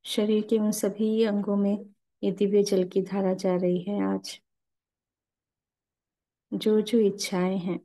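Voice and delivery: calm, unhurried meditation guide with long pauses; guided meditation. Acted. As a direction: lead the masters gently into stillness and prayer.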